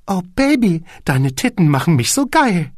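high, loud, hammed-up cooing; mock-sultry purr. A narrator's reading. Porn actress delivering her line on set, hammed-up cooing. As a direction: coo it like bad porn acting. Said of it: "holte tief Luft und säuselte".

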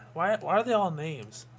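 ordinary talk

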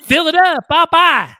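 high, sing-out Southern comic; country comedian's high-pitched farewell; James Gregory. Acted. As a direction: call out the punchline way up high. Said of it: "That sound like him, Dave?".

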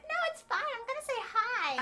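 very high mock friend voice; imitating carefree friend. Imitating carefree friend insisting on greeting.

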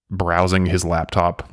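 usual speaking voice

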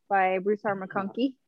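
usual speaking voice

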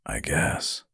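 soft, low, hurt withdrawal; hushed and deflated; wounded. A narrator's reading. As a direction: answer quietly, as if hurt. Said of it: "gave him a wounded look".

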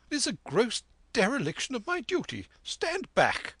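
sharp high outburst, rising to the end; crying out indignantly. A narrator's reading. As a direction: squire flares up, pitch shooting up. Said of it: "cried the squire".

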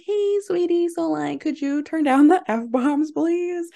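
high, sugary mom asking nicely; sweet mom voice. Retold or imitated. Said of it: "Was it, like, your mom".

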